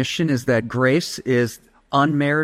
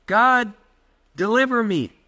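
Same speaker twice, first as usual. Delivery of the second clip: pleading Paul; 8 semitones higher than usual. Retold or imitated. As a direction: voice lifted high, crying out to God. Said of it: "Paul's like".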